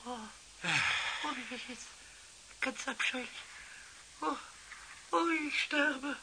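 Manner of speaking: soft, dying moans; sick moaning. Acted. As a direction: moan weakly as if sick. Drunk Pumuckl feels sick.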